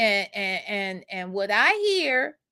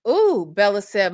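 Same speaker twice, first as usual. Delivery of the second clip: ordinary talk, nothing marked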